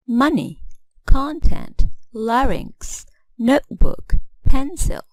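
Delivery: "Money, content, larynx, notebook, pencil", each punched high on its first syllable; first-syllable stress. Shown as a demonstration. Teacher listing example nouns, "Money, content, larynx, notebook, pencil" stressed on first syllables. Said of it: "Stress will fall on the first syllable".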